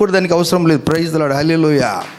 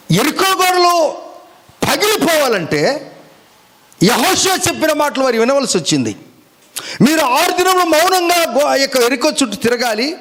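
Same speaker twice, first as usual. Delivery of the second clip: preaching; 8 semitones higher than usual. Acted. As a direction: proclaim it like a command from the pulpit. Preacher voicing Joshua's command.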